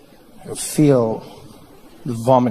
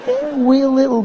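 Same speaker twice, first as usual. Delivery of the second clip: high, drawn-out Scottish lilt; tiny-sounding Scottish; 9 semitones higher than usual. Acted. Comic showing how Scots make drink sound tiny, high lilt. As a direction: shrink it to something harmless, in a Scottish accent. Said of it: "Sounds tiny".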